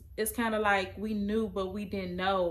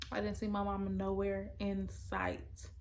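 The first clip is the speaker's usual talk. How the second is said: usual speaking voice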